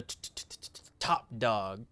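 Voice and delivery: imitating a stutter on t; stammering t- t- t- before top; mock stutter.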